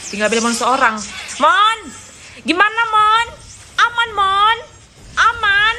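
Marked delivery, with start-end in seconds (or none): 1.4-5.8 excited calls. Real, not acted. Calling out to teammate Belmont.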